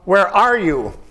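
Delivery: "Where are you?" with ARE lifted, then falling away on "you"; stress on ARE. Shown as a demonstration. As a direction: put the weight on the word "are". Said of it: "How else could you read it?".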